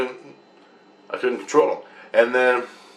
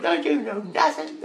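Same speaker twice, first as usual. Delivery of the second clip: put-on eight-year-old child; 12 semitones higher, 3 dB quieter than usual. Young daughter chiding dad, high child voice. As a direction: imitate a little kid scolding. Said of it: "eight-year-old".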